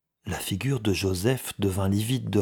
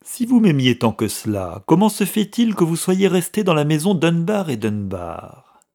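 raised, louder accusing question; scornful challenge; 8 semitones higher, 6 dB louder than usual. A narrator's reading. Brother challenging the clerk's loyalty, scornful. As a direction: press the reproach indignantly.

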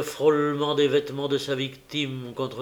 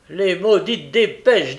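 loud, high, drawn-out curse; fed-up driver's outburst; exasperated courier; 5 semitones higher, 8 dB louder, slower than usual. A narrator's reading. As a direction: complain about the delay. Courier curses late dispatches.

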